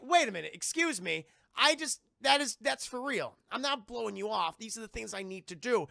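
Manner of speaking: protest, acting taken aback; playing affronted and defensive; mock offended. Acting Jean offended at accusation.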